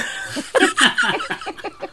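squealing laugh; high burst of laughter. Real, not acted. Reading her mug aloud, a high squealing laugh.

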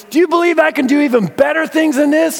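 high, loud rallying call; rally the crowd like a ringmaster; showman challenge. Retold or imitated. Walker rallying the crowd, high loud showman call.

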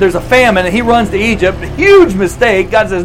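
usual speaking voice

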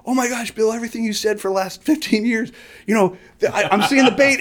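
high-pitched imitation of astonishment; amazed fans. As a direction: imitate people gushing in amazement. Mimics amazed people praising his advice.

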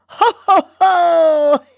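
high, loud ho ho ho; hearty ho ho ho. Shown as a demonstration. Host laughing along with listeners, high loud ho ho ho. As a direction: throw your arms up and laugh it out. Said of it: "try this approach with me".

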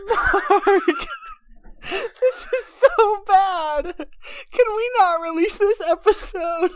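high laughing plea; crying with laughter; laughing and pleading. Real, not acted. Pleading mid laughing fit.

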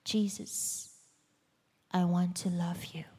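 hushed, unvoiced plea to Jesus; whispered prayer. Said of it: "And I prayed".

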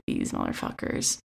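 a son's mock whispered curse; imitated whispered grumble. Brother grumbling alone in bed at night, whispered. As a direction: whisper it under the breath, bitter. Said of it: "he lays in bed at night and is like".

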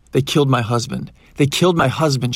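loud, anguished outcry; yelling in agony. A narrator's reading. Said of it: "she yelled in agony".

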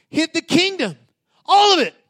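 high, loud declaration; emphatic preaching. Real, not acted. Preacher proclaims to congregation, loud and high. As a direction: drive it home with full force.

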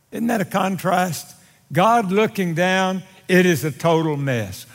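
ordinary talk

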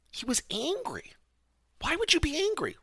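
puzzled at the friend's anger, climbing high in disbelief; incredulous. Real, not acted. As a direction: pitch jumps up in disbelief. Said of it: "disbelief at friend's anger".

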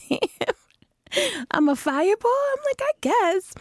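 high, stunned question then shrug; mock amazement. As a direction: play up the surprise, then accept it. Reacting to friend's fireball description.

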